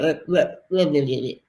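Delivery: imitated garbled stammer; tongue-tied stumbling. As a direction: trip over your tongue in nonsense syllables. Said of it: "I did stumble through my words".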